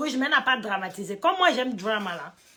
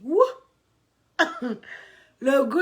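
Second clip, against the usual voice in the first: ordinary talk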